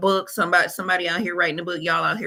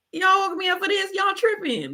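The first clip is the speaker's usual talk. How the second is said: a much higher, exasperated retort; annoyed Jesus; 13 semitones higher than usual. Retold or imitated. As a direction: woken up for nothing, fed up.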